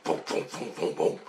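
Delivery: soft, voiceless thudding chops; quiet mouth-made chopper noise; imitated helicopter rotors. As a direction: puff out a steady rotor beat.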